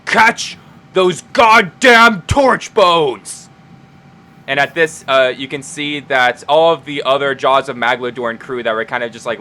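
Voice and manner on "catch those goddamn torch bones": very slow, loud, drawn-out bellow; booming command. Acted. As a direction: roar the order across the whole town. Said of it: "his low, booming voice say".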